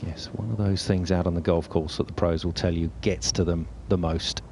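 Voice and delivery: usual speaking voice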